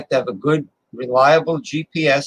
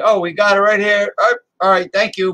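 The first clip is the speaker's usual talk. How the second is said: higher, brighter voice of a counter clerk; cheery restaurant worker; 6 semitones higher, 4 dB louder than usual. Pretend. Restaurant staff answering the driver, mock cheerful. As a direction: play the friendly staff handing over food.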